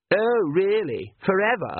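high, slow, mocking teenage sneer; sarcastic. A narrator's reading. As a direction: sneer the question back at him. Sarcastic daughter to father.

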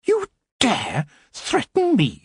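high, slow, outraged queen voice; furious queen. A narrator's reading. Queen to threatening goblin, furious. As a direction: let each word drip with fury. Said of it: "she said".

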